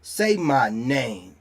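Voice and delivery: low and drawn out, each word weighed; slow commanding demand. Real, not acted. Taunting online critics, demanding slowly. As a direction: dare them to say it, slowly.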